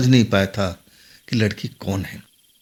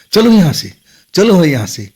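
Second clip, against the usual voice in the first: loud, higher-pitched urging; urgent command; 5 semitones higher, 6 dB louder than usual. A narrator's reading. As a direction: order him away in a raised voice. Girl urgently pulls him from river. (ज़ोर से, हड़बड़ी में आदेश)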